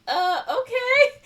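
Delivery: very high, loud, slow okay; mock baffled assent. She lets the bellboy open it, mock baffled assent. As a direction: agree uncertainly, stretched out way up high.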